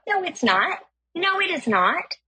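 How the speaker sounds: high-pitched mock protest; indignant contradicting. Driver disputes the price with stranger, indignant. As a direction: push the voice up and refuse to believe it. Haggling quarrel over price.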